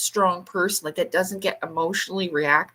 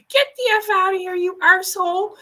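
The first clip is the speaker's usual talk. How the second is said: higher, louder, fast furious snap; angry retort; 12 semitones higher, 4 dB louder than usual. Retold or imitated. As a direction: tell her off hotly. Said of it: "they're like".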